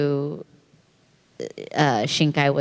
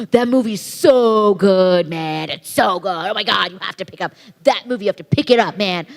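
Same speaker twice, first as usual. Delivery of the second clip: overselling; 3 semitones higher, 4 dB louder than usual. Retold or imitated. Man at fair pitching a DVD to passers-by, overselling. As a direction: mimic a salesman so keen he sounds sarcastic. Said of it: "overselling it. I thought he was being sarcastic".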